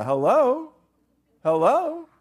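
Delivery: high, rising "hello?"; mock calling out. Preacher voicing Job calling to an unresponsive God, mockingly. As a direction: call out as if nobody is listening.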